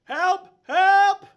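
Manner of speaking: loud high cry for help; guard screaming help; guard yelling for help. Acted. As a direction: shout for backup at the top of your voice. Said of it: "And he goes".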